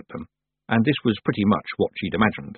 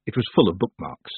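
ordinary delivery

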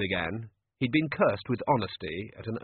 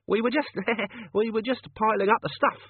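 much higher, flustered with a nervous laugh; nervous henchman; 12 semitones higher than usual. Acted. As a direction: caught red-handed, stammering an excuse. Chicken Wire caught out, nervous.

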